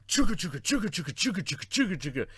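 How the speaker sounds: high, loud chugga chugga train noise; chugging toy train voice. Retold or imitated. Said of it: "like he was a fucking train".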